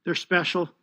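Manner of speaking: real emotion, soft and quick, fighting tears; a voice catching while thanking his family; choked up. Real, not acted. Thanking his family at the press conference, choked up. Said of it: "I didn't think I'd get emotional".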